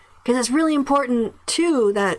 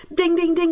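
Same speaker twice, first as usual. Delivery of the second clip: higher-pitched bell chime imitation; mock quiz bell; 7 semitones higher than usual. Host rewarding a good point, mock bell. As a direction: ring it out like a winning bell. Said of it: "like, a buzzer".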